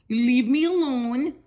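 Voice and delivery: normal talking voice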